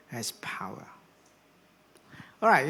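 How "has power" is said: whispered close to the line; whispered ending of the prayer. Shown as a demonstration. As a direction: breathe the last words out. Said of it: "when you are down in the doldrum".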